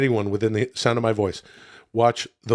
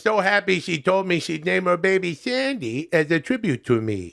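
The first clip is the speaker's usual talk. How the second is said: proud, pitched-up comic storyteller; chummy reviewer spinning a tall tale; Adam Sandler; 6 semitones higher than usual. Acted.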